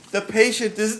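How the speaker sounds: ordinary delivery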